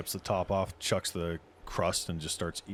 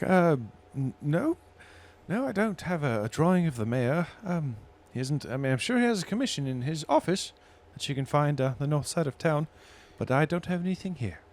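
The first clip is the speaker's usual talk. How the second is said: higher barmaid voice; 6 semitones higher than usual. Acted. GM voices barmaid answering player.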